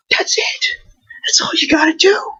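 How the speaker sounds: breathy whispered aside, no voice. Real, not acted. Telling sports fans to leave his points alone, whispered. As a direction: drop to a whisper to drive it home.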